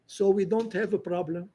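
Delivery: ordinary talk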